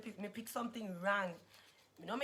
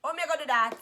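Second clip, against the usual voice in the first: usual speaking voice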